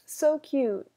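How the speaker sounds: high, loud but short; brief emphatic coo; gushing echo. Real, not acted. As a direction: repeat the gush, reined in a little. Echoes the gush, shorter.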